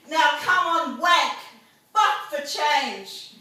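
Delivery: high, loud slogan chant; rallying shout. Acted. Poet urging the crowd to act, shouting. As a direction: punch out each call to rouse the crowd.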